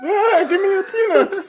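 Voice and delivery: high, slow, laughing chipmunk squeak. Pretend. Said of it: "you wanna try and do chipmunk voices real quick?".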